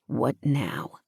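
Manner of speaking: low, soft, falling question; male lead's question. A narrator's reading. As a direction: ask it low and soft, letting it fall. Hero asks, heroine echoes.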